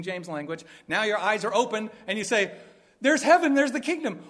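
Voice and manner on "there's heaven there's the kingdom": high, loud cry of sudden sight; excited discovery. Acted. Preacher voicing a sinner whose eyes just opened, excited. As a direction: exclaim it like you just spotted it.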